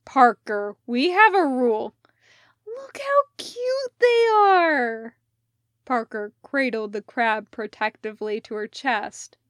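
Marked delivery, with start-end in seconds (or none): 0.0-1.9 loud, firm rebuke; stern scolding. Acted. Elliot scolds Parker. 2.7-5.2 high, slow, delighted coo; gushing. Acted. Parker gushes over the crab.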